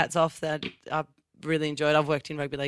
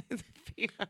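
laughing; 2 semitones higher, 15 dB quieter, faster than usual. Real, not acted. Laughing at own slip of tongue.

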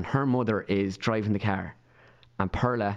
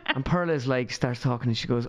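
usual speaking voice

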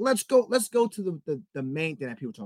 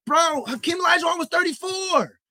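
high-pitched, loud outburst of disbelief; exasperated shout; 12 semitones higher, 6 dB louder than usual. Real, not acted. Host arguing Olajuwon was past his prime, exasperated shout.

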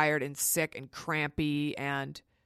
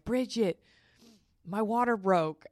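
higher-pitched retold announcement; excited announcement; 5 semitones higher than usual. Expectant mother phones her doula, excited announcement. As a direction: call the name up high, then share big news.

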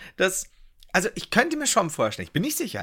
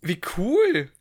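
excited; 5 semitones higher, 4 dB louder than usual. Real, not acted. Excited reaction.

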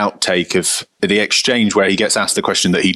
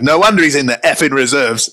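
higher and louder parting shot in a put-on voice; mock furious jibe; 7 semitones higher, 4 dB louder than usual. Harry Redknapp mocking a player after a near miss, angry. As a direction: spin round and snap it at the player. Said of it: "Turns around and goes".

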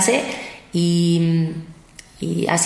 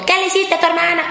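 high, raised scolding question; mock scolding mom; 9 semitones higher, faster than usual. Mother scolding her son about his sister, raised scolding voice. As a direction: play the mother demanding an answer.

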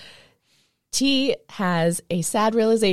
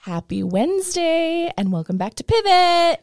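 bright show intro; 10 semitones higher than usual. Acted.

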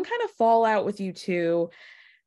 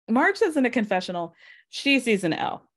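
ordinary talk, nothing marked

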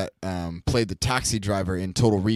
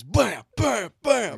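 high, punched-out mock gunshots; loud gunshot imitation; 13 semitones higher, 5 dB louder, much slower than usual. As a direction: fire the words off like shots. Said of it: "Really loud".

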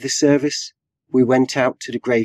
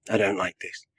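quiet unease; 2 semitones lower, 8 dB quieter than usual. A narrator's reading. Rabbi finding himself out of his body, quietly uneasy. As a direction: say it softly, almost to yourself. First take, before crying it out.

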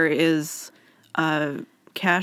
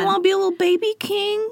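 put-on high cooing baby voice, much higher; baby talk; 11 semitones higher, faster than usual. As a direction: tease him like cooing at a baby. Teasing baby talk addressed.

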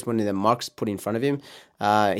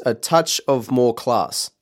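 slow, drawn-out ad read; much slower than usual. Shown as a demonstration. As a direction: savour each word like a classy slogan. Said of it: "so I'll read the advertisement".

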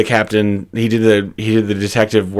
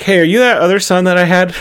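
higher, fast startled question; mock surprised mom; 7 semitones higher, faster than usual. Mother realizes her lost twin son, quick surprised blurt. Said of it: "she's like".

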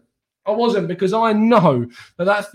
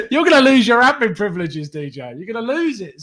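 higher, louder mock warning through laughter; laughing mock threat; 5 semitones higher, 3 dB louder than usual. Real, not acted. Teasing a viewer named DJ, laughing and higher. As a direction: tease him with a laughing threat.

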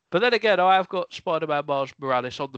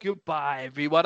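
normal talking voice

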